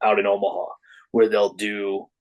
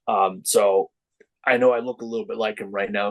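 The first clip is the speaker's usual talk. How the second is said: ordinary talk, nothing marked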